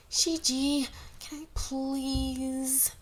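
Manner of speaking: high, slow eager plea; young girl pleading. A narrator's reading. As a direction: beg sweetly like a little girl.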